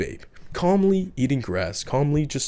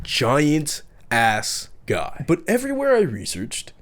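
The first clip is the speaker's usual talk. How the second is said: normal talking voice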